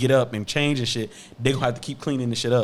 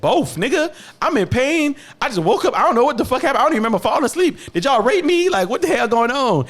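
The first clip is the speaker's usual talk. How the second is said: higher and louder, panicked rush; frantic retold outburst; 11 semitones higher, 4 dB louder than usual. Patient answering the nurse, higher louder mock panic. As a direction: replay your groggy freak-out. Him demanding both parents.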